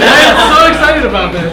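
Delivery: thrilled, loud and high; excited shout. Real, not acted. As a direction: shout it out, overjoyed. Just won the raffle.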